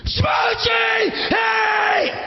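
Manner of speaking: high-pitched manic greeting scream; yell. Retold or imitated. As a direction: go nuts calling out to a hero. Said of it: "I yell".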